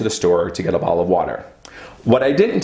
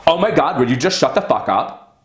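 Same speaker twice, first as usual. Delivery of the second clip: higher, fed-up outburst; mock exasperated snap, pitched up; 6 semitones higher than usual. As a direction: lose it at him. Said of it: "and I'm like".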